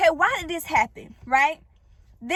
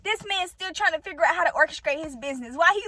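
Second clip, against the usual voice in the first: ordinary talk, nothing marked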